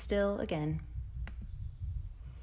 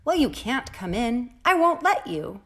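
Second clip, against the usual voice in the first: louder, much higher, stubborn no; firm refusal; 5 semitones higher, 5 dB louder than usual. Acted. Rosebud shuts out the rain, loud and high. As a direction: refuse it flat out, rising high.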